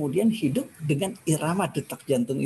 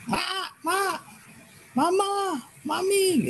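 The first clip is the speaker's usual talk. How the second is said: put-on high, whiny child calling for mama; crying toddler; much slower than usual. Speaker imitates a crying toddler left by mama, high whining. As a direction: mimic a small child left by its mother.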